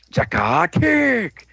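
slow, high drawn-out move name; mock attack call. Storyteller acting out the team's kick, high slow battle cry.

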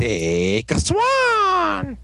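very slow, high-pitched cry; mock transformation call. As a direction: stretch the name out like a hero's roll call.

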